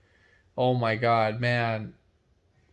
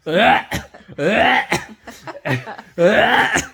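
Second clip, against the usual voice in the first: loud gagging ughs, going higher; retching ugh; 6 semitones higher, 5 dB louder, much slower than usual. Retold or imitated. Retelling scooping it up, gagging loudly. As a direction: gag out loud on each ugh. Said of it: "I was like".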